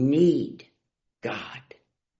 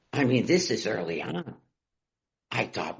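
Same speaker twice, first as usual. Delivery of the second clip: normal talking voice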